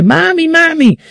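put-on high-pitched child calling for mother; imitated crying child. Her son waking at night to call mother, imitated tearful child. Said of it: "despierta el niño llorando y dice".

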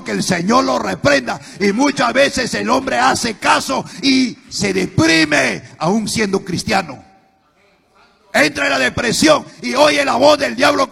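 loud, high, rising preacher's cry; fiery preaching. Acted. Pastor warning the congregation about the devil, fiery preaching. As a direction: preach it to the rafters.

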